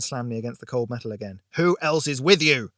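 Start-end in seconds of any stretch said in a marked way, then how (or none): 1.5-2.7 loud, angry interrogation; explosive, threatening demand; furious demand. A narrator's reading. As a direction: shout the question, pitch raised. Repeated demand, slamming captive, escalating.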